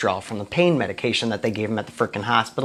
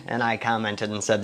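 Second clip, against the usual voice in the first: ordinary talk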